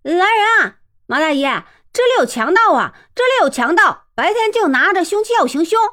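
loud high shouting toward the gongs; shouting for help. Acted. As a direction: raise the alarm at the top of the voice. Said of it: "就是一阵喊". (高声大喊)

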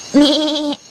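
a light, voiced baa of a little lamb; lamb bleating. Lamb calling from inside the hut, gentle bleat. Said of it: "只听屋里有叫声".

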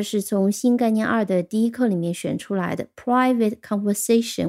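ordinary talk, nothing marked

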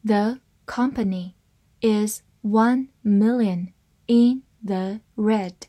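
slow, separated words; slow reading. Shown as a demonstration. English teacher models the company sentence, reading slowly. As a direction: read it slowly, word by word. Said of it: "慢慢来读".

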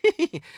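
a cocky monkey's pleased little laugh; sly chuckle. A narrator's reading. Wukong chuckling to the monks, sly cackle. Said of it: "悟空笑道".

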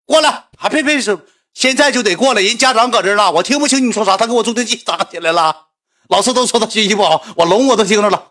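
high, insistent calling-out; deaf grandpa on phone. Retold or imitated. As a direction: summon someone down the line without hearing a word. Acted deaf grandpa summoning parent by phone.

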